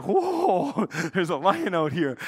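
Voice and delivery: startled. Retold or imitated. Preacher replaying his reaction to a nearby lion, startled. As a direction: relive the jolt with a laugh in it.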